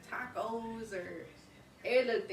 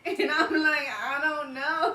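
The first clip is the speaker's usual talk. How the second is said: laughing; 6 semitones higher, 11 dB louder than usual. Real, not acted. Laughing at grandma's question.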